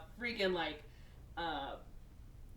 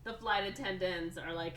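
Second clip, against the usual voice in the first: ordinary delivery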